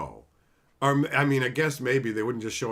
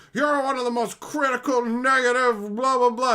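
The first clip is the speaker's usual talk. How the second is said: mock critic; 11 semitones higher, 6 dB louder than usual. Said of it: "when the guy is".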